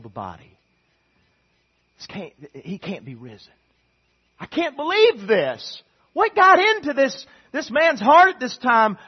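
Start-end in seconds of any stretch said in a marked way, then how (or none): 4.4-9.0 exclaimed disbelief. Acted. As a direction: cry out in amazed disbelief.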